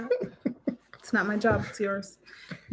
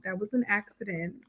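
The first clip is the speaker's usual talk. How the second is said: ordinary talk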